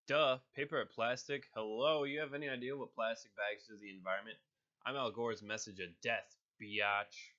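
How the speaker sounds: loud, cocky natural voice turned up; Deadpool voice. Acted. Voice actor doing Deadpool, loud and cheeky. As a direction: crank it up, smart-mouthed mercenary. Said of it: "Next, I'm gonna do Deadpool".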